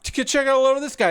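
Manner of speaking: high, fast New York street call; mock New York guy. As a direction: rattle it off like a nosy New Yorker calling out. Imitating a New Yorker's street call.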